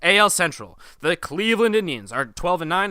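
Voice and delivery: punchy radio standings read; sportscaster voice. Acted. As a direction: announce it like a sports anchor.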